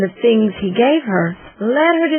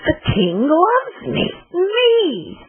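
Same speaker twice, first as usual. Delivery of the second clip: rising, lightly awed realization, climbing high on the last word; dawning joy; 8 semitones higher, much slower than usual. A narrator's reading. The queen realizing the king loves her, rising joy. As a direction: let the wonder build until it breaks out.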